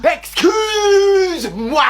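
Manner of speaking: high, loud, hugely stretched outburst; outraged exclamation. Acted. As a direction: drag it out in shocked outrage. Mère outrée par son fils. (exclamation outrée, aiguë et très étirée)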